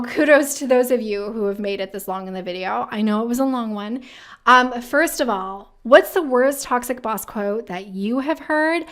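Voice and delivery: usual speaking voice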